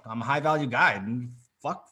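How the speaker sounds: normal talking voice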